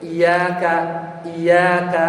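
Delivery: "iyyaka" with one light y: i-yaa-ka; "iyyaka" read as i-yaa-ka; without tasydid. Shown as a demonstration. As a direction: glide through "iyyaka" without doubling the y. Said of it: "kalau dibacanya nggak tasdid".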